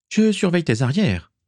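put-on high young woman's voice mid-fight; Morgan's voice. Morgan reassuring Johan during battle, high voiced. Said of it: "fit-elle".

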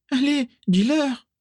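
high, slow squeaky plea; pleading. A narrator's reading. Mimi urging Régulus to back her up, pleading. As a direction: beg him in a small high voice.

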